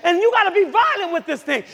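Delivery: high, loud preacher shouts; fiery pulpit shouting; full-force preaching. Real, not acted. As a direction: punch out short commands to the congregation.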